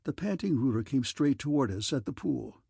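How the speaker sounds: plain reading voice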